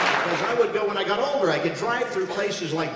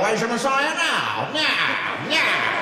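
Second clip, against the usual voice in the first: slow gangster snarl; Edward G. Robinson; 5 semitones higher, slower than usual. Retold or imitated. Egyptian official taunting the Hebrews, gangster drawl. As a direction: sneer it like a thirties gangster. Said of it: "Edward G. Robinson".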